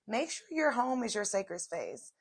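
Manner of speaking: usual speaking voice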